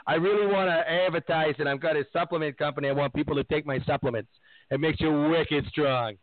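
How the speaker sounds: lightly put-on Boston accent mocking Wahlberg; a hint of Boston guy voice. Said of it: "My Boston accent sucks tonight".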